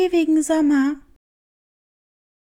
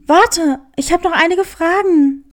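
louder call after someone leaving; 5 dB louder than usual. Acted. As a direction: shout after the vanishing cat. Hare calls after vanished cat. (laut hinterherrufend)

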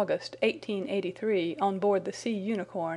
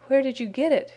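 higher, louder anguished groan of a caught man; 5 semitones higher, 6 dB louder than usual. A narrator's reading. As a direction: blurt it out in despair. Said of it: "he groaned".

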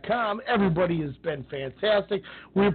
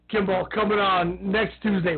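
ordinary talk, nothing marked

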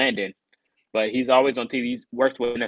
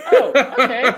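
laughing in disbelief; 12 semitones higher, 9 dB louder than usual. Retold or imitated. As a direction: laugh through the words. Retelling being dropped in rain.

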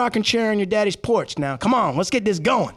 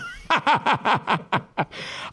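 long staccato laugh; 5 dB quieter than usual. Real, not acted. Laughing at cousin's insult before the take, long staccato laugh. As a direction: burst out in a run of chuckles.

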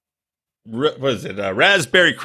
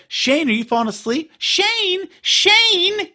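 imitated girlfriend, pitch climbing with each call; slower than usual. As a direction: call a dozing partner's name, higher and higher. Acted girlfriend calling to sleeping boyfriend.

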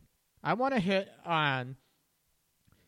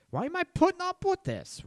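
high-pitched, incredulous demand; exasperated outburst; 3 dB louder than usual. Acted. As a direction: blurt it out high, fed up. Imagined self fed up, confronting.

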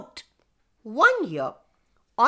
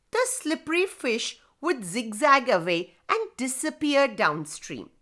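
ordinary delivery, nothing marked

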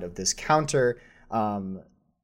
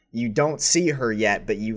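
ordinary delivery

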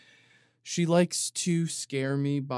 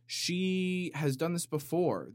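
ordinary talk, nothing marked

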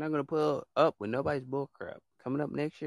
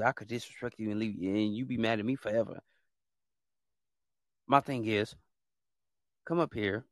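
ordinary talk

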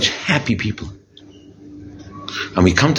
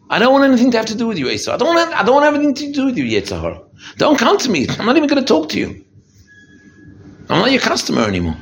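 rebuffing the tempter; 9 semitones higher than usual. Pretend. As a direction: slam the door on the evil inclination. Rebuffs Esav and the evil inclination.

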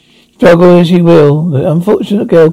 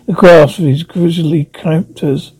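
ordinary delivery

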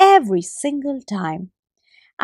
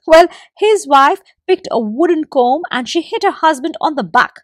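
ordinary delivery, nothing marked

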